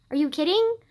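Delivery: incredulous, rising challenge. Real, not acted. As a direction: repeat it in mounting outrage. Repeats question in mounting disbelief.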